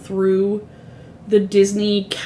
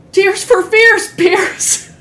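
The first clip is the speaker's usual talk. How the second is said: loud, high, drawn-out silly cry; comedian's bit impression; 10 semitones higher, 5 dB louder, much slower than usual. Retelling a comic's rhyming bit, shouting at Pierce, silly high impression. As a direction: yell the rhyme in a hammed-up high voice. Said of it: "says a bunch of words that rhyme with it".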